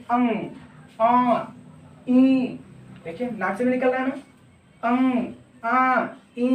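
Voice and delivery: अं आ ई hummed through the nose; nasal vowel demo. Shown as a demonstration. Teacher demonstrating nasal vowels for the class. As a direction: let the air ring through the nose on अं. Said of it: "example के तौर देखिए".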